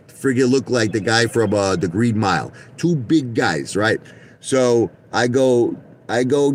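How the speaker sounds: ordinary talk, nothing marked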